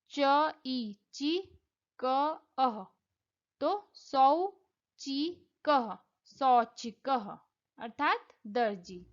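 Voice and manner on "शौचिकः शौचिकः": शौचिकः drawn out slowly, final ह audible; slow careful pronunciation. Shown as a demonstration. Teacher slowly modeling a word for students: slow careful शौचिकः. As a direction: sound out शौचिकः syllable by syllable. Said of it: "demonstrating syllable joining".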